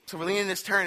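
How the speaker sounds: usual speaking voice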